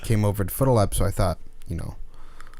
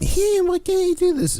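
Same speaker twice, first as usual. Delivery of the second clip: very high whiny voice; mock whiny customer. Customer demanding service, high whiny mock voice. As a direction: mimic a shrill complaining customer. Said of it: "And they're like".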